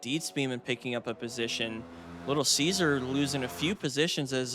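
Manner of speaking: ordinary delivery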